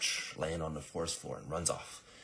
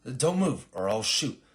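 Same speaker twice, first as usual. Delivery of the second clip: loud, raised acted warning; alarmed threat; 7 semitones higher, 9 dB louder than usual. Startled assassin aiming at girl, loud threatening command. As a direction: order her to freeze.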